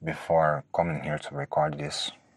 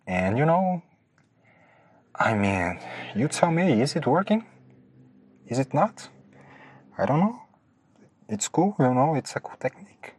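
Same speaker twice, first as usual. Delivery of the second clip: odd high voice; 10 semitones higher, 4 dB louder than usual. Real, not acted. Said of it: "Why am I talking like this?".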